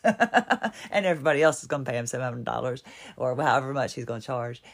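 words spill out through a real laugh; laughing while talking. Real, not acted. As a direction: laugh through the line, amused. Laughs at doctor's pricing.

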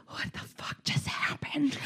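slow, breathless whisper of disbelief; stunned whisper. Retold or imitated. Mom lying in her son's bed, slow stunned whisper. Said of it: "I just laid in his bed like".

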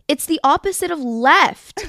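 retold fed-up outburst. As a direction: raise your voice, out of patience. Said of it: "I was raising my voice 'cause I was getting so frustrated".

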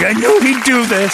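high, loud mock Kara voice. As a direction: squeal it like she saw it coming. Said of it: "To have Kara sitting there watching".

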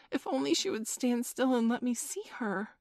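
high, eager, wishful exclamation; yearning cry. A narrator's reading. Wendy begs that Tink stand still, yearning cry. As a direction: wish it aloud, pitched well up. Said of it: "she cried".

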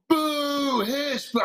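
high, drawn-out mock boos; booing crowd. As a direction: imitate a hostile crowd booing, without volume. Imitates the booing college crowd.

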